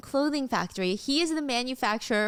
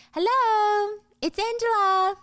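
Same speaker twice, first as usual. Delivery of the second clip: high, louder teasing come-on; flirty call; 13 semitones higher, 5 dB louder than usual. Acted. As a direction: coax a shy client out. Angela enters client's room flirting.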